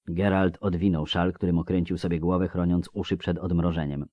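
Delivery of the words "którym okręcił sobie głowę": plain reading voice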